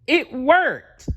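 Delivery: high, loud cheer. A narrator's reading. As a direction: celebrate out loud. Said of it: "cheered Bedtime Bear".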